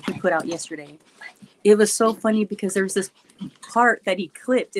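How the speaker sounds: ordinary delivery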